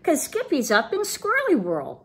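high singsong sisters. A narrator's reading. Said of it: "sang his sisters".

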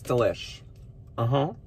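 speaking mid-bite; muffled by food in mouth; mouth on a burger. Real, not acted. Seen 0: Holds burger; bites it from 0.6s, not clearly chewing at start.

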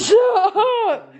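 high, laughing disbelief; laughing exclamation. Real, not acted. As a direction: squeal it through laughter. Laughing reaction to the phone call.

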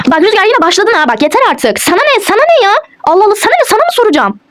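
high-pitched, indignant snapping; angry. Acted. Wife snapping at husband who came to check, angry. Staged quarrel after he checks.

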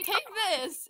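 usual speaking voice

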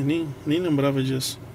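ordinary talk, nothing marked

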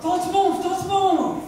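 high, urgent don't move; excited screech. Acted. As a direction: freeze her in place, thrilled. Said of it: "Benji screeched excitedly".

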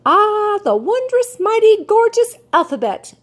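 high, awed bird voice lingering on gorgeous; marveling. A narrator's reading. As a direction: marvel at it like a treasure. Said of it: "she marveled".

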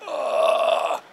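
a low, breathy, unvoiced groan of soreness; pained groan. Imitating a sore touring musician, a low breathy groan. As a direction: groan like your back just seized. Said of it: "And you're just like".

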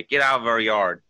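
mock shouted complaint; retold yell. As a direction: act out shooing off a trespasser. Acting neighbours ordering her away.

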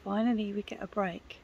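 hushed whisper, almost no voice. Real, not acted. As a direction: breathe it out as a whisper. Quiet break after driving.

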